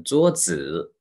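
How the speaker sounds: slow 桌子, long 桌 and light 子; neutral-tone demo. Shown as a demonstration. Teacher models slowly for students, 桌子 with 子 in neutral tone. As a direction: stretch 桌, then a light unstressed 子. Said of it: "轻neutral tone，just right".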